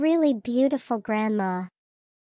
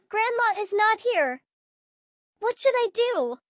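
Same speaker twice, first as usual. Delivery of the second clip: put-on much higher, alarmed child voice; panicked confusion; 7 semitones higher than usual. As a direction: call for grandma in a growing panic. Child can't stop dancing, panicking.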